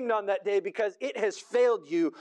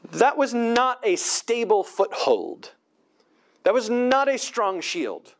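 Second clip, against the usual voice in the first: preaching at full force; 3 semitones lower, 5 dB louder than usual. Acted. Preacher warning the congregation about trusting Egypt, forceful. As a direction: drive each "not" home from the pulpit.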